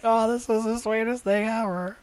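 put-on girlfriend voice. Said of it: "she was like".

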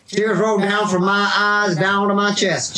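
a hammed-up cowboy accent, slowed right down; Wild West accent. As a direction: drag the words out like an old Western frontier woman. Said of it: "I'll do the Wild West accent".

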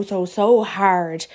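slow, each "so" stretched out; emphatic drawn out. Real, not acted. Venting about TikTok creators, "so, so hard" drawn out slowly.

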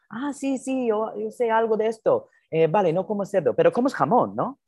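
voz aguda y suave de viuda madrileña; voz de señora. Retold or imitated. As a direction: imitando a la casera, aguda y confiada. Voicing old Madrid landlady. (imitación aguda de la señora Maruja)

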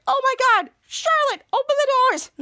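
high, loud, panicked yelling at Charlotte; frantic shout. Retold or imitated. Teacher yelling at Charlotte to open the doors, high frantic shout. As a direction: shriek it like the biker is right here. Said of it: "Miss Shapen's like".

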